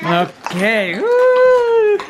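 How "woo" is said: excited woo. Real, not acted. Celebrating winning gas money.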